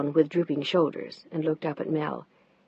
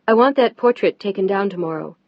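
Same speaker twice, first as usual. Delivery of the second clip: louder flat demand; louder firm demand; 7 dB louder than usual. Acted. Mel confronting Peter.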